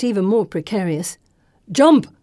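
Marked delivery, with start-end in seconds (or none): 1.7-2.1 loud, high urgent call. A narrator's reading. Companion urging a leap between boats, calling. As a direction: shout it as an urgent order. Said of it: "Kartik calls".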